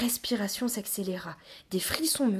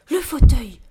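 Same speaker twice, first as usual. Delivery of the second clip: loud, higher burst of hope; sudden realization; 6 semitones higher, 15 dB louder than usual. Acted. Trapped child spots a way out, sudden realization. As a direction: seize on the escape idea out loud.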